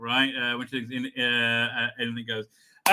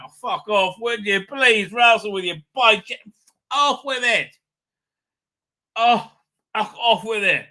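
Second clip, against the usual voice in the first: rising, indignant telling-off; exasperated rejection; 5 semitones higher, 4 dB louder than usual. Real, not acted. Host dismissing Russell's bi-gen idea, exasperated. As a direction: wave it away, louder and higher as it goes.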